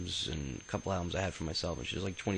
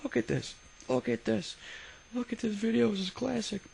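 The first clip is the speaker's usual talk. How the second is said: much higher, louder, delighted teacher voice; laughing teacher; 3 dB louder than usual. Retold or imitated. Teacher laughing at the hanging film, high delighted squeal. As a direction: hoot it out like a teacher crying with laughter.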